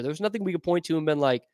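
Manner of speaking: ordinary talk, nothing marked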